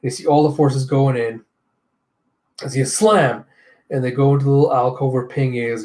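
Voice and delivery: usual speaking voice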